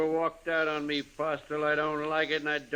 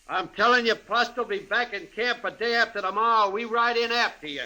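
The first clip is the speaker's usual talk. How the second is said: threat; 6 semitones higher, 3 dB louder than usual. Acted. Gang boss threatens Postle.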